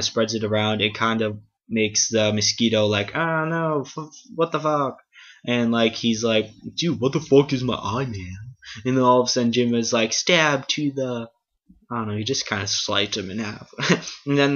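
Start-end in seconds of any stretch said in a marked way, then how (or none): 3.1-5.0 high squealing bug voice, higher; cartoon insect freaking out; mosquito voice. 6.7-8.4 mosquito voice. Retold or imitated. Mosquito keeps complaining. 10.1-11.3 mock battle cry. Jim's acted attack line.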